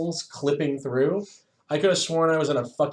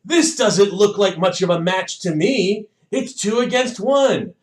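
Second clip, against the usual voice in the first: loud, high brash challenge; challenging fighter; 7 semitones higher, 6 dB louder than usual. Retold or imitated. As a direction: call out the match through a megaphone. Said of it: "and goes".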